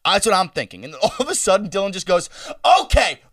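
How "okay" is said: raised voice. Retold or imitated. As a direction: shout it like a sudden takeover. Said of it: "the second Dylan raised his voice".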